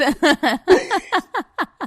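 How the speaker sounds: big loud burst of laughter. Real, not acted. Laughs at task list joke.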